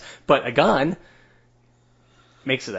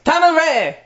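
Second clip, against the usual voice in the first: high, loud and eager; excited; 13 semitones higher, 5 dB louder than usual. Real, not acted. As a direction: shout it out, raring to go. Excited reply to timer question.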